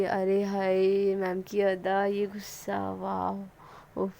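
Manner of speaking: a dreamy inner gush that trails away; swooning inner aside. Retold or imitated. Sonal swoons inwardly over her angry teacher, softly fading. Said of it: "अपने heart में बोलती है".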